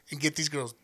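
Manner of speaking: ordinary delivery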